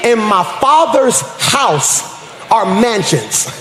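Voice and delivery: slow, weighted phrasing with a gap mid-verse; slow repeat. Shown as a demonstration. Preacher repeating the verse to a crowd that missed it, slow. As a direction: repeat it slowly, letting it land. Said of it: "I'm gonna say this slow".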